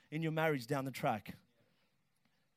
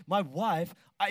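ordinary talk

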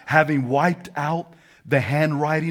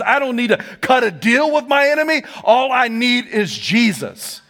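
preaching the refrain, rising and louder; full preaching; 8 semitones higher, 7 dB louder than usual. Acted. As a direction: build each line toward the name of Jesus.

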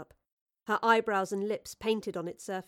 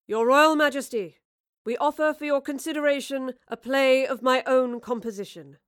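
loud, higher, grand courtly address; formal address; 4 semitones higher, 7 dB louder than usual. A narrator's reading. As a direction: announce the play to the monarch. Playwright addresses the Queen.

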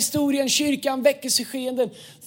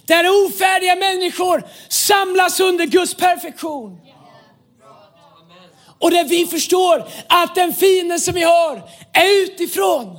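raised, insistent preacher's voice; forceful preaching; 3 semitones higher than usual. Real, not acted. Preacher warning the church about the enemy who scatters, forceful preaching. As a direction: preach it with building urgency.

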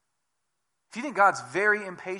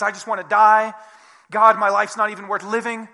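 despairing; 5 semitones higher, 14 dB louder than usual. Retold or imitated. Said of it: "and he goes".

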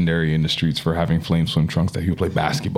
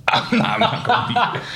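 giggling, pitched up; laughing high repeat. Shown as a demonstration. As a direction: laugh through the line.

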